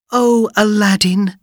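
high, loud relieved cry; relieved princess. Acted. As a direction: greet him with relief.

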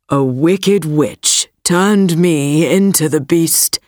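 put-on low, steady young prince; a prince explaining, pitched low. A narrator's reading. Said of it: "said the prince".

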